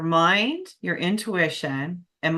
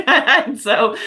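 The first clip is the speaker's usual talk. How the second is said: laughing; 7 semitones higher, 8 dB louder than usual. Real, not acted. Realizes she's an emotional eater.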